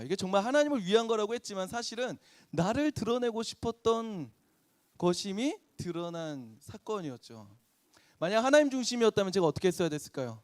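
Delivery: ordinary delivery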